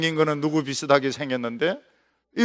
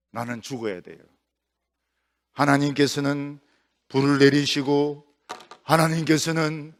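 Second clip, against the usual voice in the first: weary despair; 7 semitones lower, 4 dB quieter than usual. Acted. 지친 엘리야의 기도.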